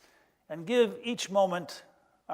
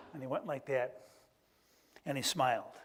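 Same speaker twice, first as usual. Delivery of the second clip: ordinary talk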